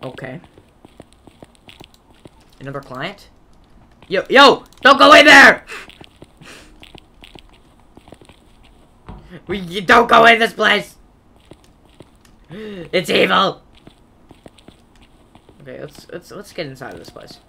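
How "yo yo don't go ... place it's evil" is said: loud urgent yell; warning shout. Real, not acted. Player shouting at a new client to stay away. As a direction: call out to stop them.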